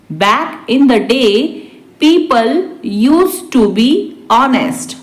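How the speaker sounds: measured dictation pace; slow repeat. Shown as a demonstration. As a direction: break it into small chunks. Teacher repeats translation word by word. (धीरे-धीरे, रुककर)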